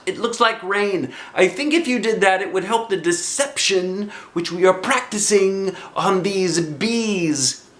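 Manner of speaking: high, bright bear voice calling out; Pooh's cheerful cry. Acted. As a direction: call like a happy little bear. Pooh voiced.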